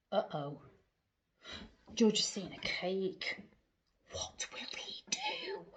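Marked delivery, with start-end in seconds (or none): 4.1-5.6 high hushed question; suspense whisper. A narrator's reading.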